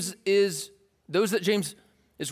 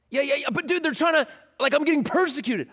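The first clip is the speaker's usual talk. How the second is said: higher exasperated pushback; whiny protest; 8 semitones higher than usual. Pretend. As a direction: argue back, fed up. Believers argue with James.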